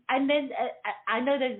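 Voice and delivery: ordinary talk, nothing marked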